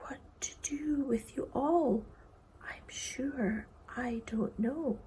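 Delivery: soft, slow whisper; quite low. Acted. Boy wondering what to do with them, hushed whisper. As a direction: murmur it in lost bewilderment. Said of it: "said quite low".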